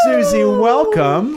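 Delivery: lightly voiced breathy welcome; a hint of Marilyn, drawn out; mock Marilyn. Host greets the guest with a mock Marilyn lilt.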